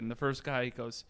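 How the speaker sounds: ordinary delivery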